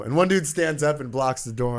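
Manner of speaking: ordinary talk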